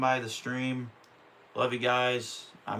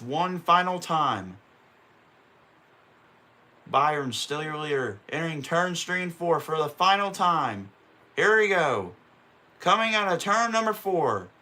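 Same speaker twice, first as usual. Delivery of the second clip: announcer call rising higher and louder at the checkered flag; race play-by-play building to the finish; 5 semitones higher, 4 dB louder than usual. Acted. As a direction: call the last lap like a TV race caller, climbing into excitement.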